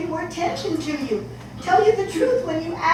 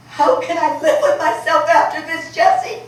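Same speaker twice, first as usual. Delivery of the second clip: anguished cry; 8 dB louder than usual. Acted. Mother pleads, distraught.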